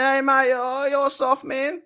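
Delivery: high, sneering parody of a troll; mock the chatter's taunt. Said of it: "coming here saying".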